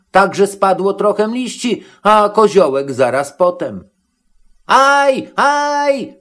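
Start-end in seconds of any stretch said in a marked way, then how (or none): 4.7-6.1 very high, drawn-out yelp; high-pitched acted cry of pain; Matołek yelping. A narrator's reading. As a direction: shriek "ow" in a high put-on voice. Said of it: "wrzasnął".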